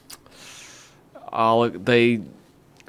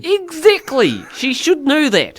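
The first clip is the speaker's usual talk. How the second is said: higher-pitched put-on Kiwi; mock New Zealand accent; 6 semitones higher, slower than usual. Co-host backing host's demo, mock Kiwi accent. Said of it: "that's her name in Kiwi".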